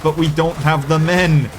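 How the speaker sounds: ordinary talk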